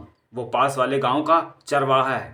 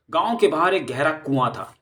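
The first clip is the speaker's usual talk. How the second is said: usual speaking voice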